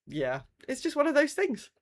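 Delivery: ordinary delivery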